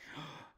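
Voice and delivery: short, soft shocked intake of breath; mock gasp. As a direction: imitate a family member gasping in shock. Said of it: "you take a deep breath".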